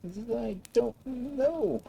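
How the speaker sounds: high, very slow, sorrowful whimper of a voice; mournful. A narrator's reading. Dragonfly admits it does not know, high, slow and mournful. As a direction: let the sadness drag every word. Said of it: "said the dragonfly mournfully".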